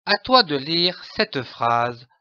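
normal talking voice

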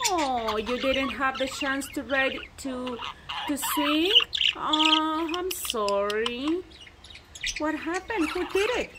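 normal talking voice

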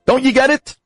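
angry homeowner. Acted. Homeowner refusing the miser again, angry and high. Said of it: "The homeowner gets angry".

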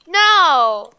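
loud anguished no; shout. Real, not acted. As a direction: cry out no in protest. Pleading over losing a pet.

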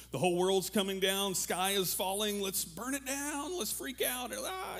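high-pitched mock doomsday screaming, drawn out; mock panic. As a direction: climb into a hammed-up, strained panic. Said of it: "everybody's screaming".

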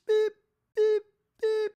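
high, loud beep tones; mock recording-booth beeps; imitated beeps. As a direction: chirp three sharp studio beeps. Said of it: "you get three beeps".

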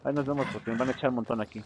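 ordinary talk

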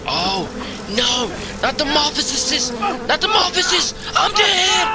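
high, loud panicked scream; terrified scream. Real, not acted. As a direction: scream as the monster strikes. Monster attack in the game.

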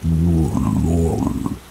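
very slow, drawn-out demonic drone. Retold or imitated. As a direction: imitate a demon's groan, stretching each sound out long. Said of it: "in this deep demonic voice".

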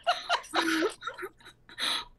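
high laugh. Acted. Cat laughs at own joke.

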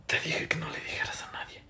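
very soft, breath-only whisper; whispered reproach. Acted. Secret-keeper's reproach.

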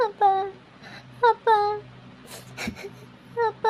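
choked tearful calls to father; broken tearful whimpers; crying. Acted. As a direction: sob out each call to dad. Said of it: "ಸುಪ್ಪು, ಅಳ್ಬೇಡ".

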